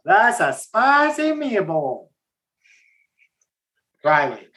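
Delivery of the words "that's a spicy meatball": higher, slow mock Italian "That's a spicy meatball"; slow, higher mock Italian catchphrase; hammed-up Italian accent. Shown as a demonstration. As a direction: ham up a cartoon Italian chef, stretching "spicy". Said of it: "Someone give me a better spicy meatball".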